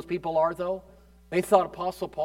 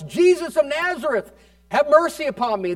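somewhat louder, higher plea; blind man's cry; 6 semitones higher, 3 dB louder than usual. Retold or imitated. Blind man calling to Jesus of Nazareth, pleading cry. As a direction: cry out for mercy over the crowd. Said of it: "was yelling".

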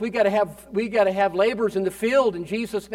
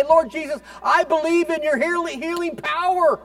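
lifted-voice prayer; 8 semitones higher than usual. Shown as a demonstration. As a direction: lift your voice to heaven in faith. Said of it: "lift your voice more than ever and say".

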